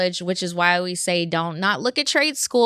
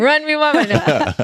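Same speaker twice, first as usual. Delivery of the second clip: laughing and pitched up; laughing demand; 7 semitones higher, 5 dB louder than usual. Pretend.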